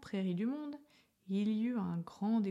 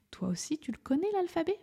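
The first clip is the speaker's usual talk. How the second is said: playful question to listener; 9 semitones higher, 3 dB louder, faster than usual. Acted. Narrator turns to child listener.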